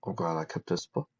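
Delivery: spoken through clenched teeth; gritted teeth. A narrator's reading. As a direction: say it with jaw clenched tight. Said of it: "he said through gritted teeth".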